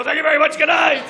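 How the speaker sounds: comic quitting the stage, loud; mock comedian sign-off. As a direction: bellow a showbiz farewell. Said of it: "and go".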